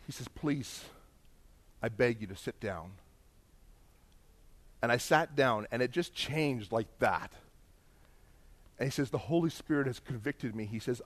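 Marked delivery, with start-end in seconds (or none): 0.3-2.9 imitating a hushed, low plea; subdued, broken-down begging; tearful pleading. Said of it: "he starts to cry". 9.1-10.6 imitated confession, soft and low; subdued, contrite murmur; tearful confession. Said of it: "he starts to cry".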